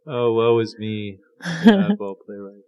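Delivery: slow, high mock lament; woe-is-me self-pity, hammed up; dramatic sigh. Mock lament about being oddball.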